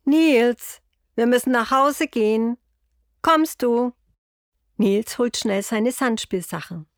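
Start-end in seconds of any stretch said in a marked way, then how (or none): 0.0-3.9 grandpa calling across the playground, louder and higher; louder, higher call to the boy. A narrator's reading. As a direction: call him home from a distance. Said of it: "ruft Opa schon".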